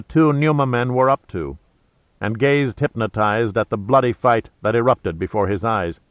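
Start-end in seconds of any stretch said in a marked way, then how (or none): none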